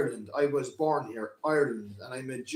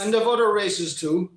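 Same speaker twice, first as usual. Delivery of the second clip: louder and higher, pushing back; heated retort; 7 semitones higher, 11 dB louder than usual. Acted. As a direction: answer him forcefully. Bloom retorts in heated argument.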